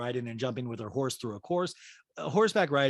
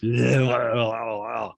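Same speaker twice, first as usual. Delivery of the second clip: loud slurpy tongue-flapping noise; exaggerated lip-licking; 2 semitones lower, 7 dB louder than usual. Host imagines tipsy mom, exaggerated lip-licking noise. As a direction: mime a sloppy, drawn-out lick of the lips. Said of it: "my mom licks her lips".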